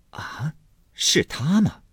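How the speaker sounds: hesitate, then ask in disbelief; taken aback. Acted. Wife learning it is her own brother, slowly astonished.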